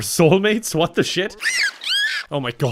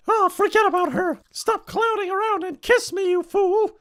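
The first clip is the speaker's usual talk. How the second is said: much higher, breathless lovestruck woman; high swooning lady. Acted. Heroine ignoring murder news to kiss clown, swooning high voice. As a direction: gush it like a besotted heroine.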